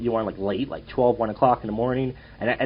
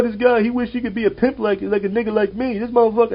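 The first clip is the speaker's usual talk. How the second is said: much higher hammed-up heckler voice; mocking bus guy; 9 semitones higher than usual. Bus heckler brags he is a pimp, high mock voice. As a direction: camp it up as the boastful self-styled pimp. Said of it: "He's just sitting there going".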